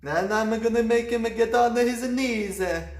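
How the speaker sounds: high, loud, hammed-up priest; over-the-top priest monologue; scheming priest voice. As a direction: relish the plan like a cartoon priest. Priest voice plotting.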